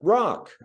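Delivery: "rock" said high and loud, like the English word rock; syllable rock. Shown as a demonstration. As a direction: give "rock" alone, loud and clear. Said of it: "if you would repeat after me".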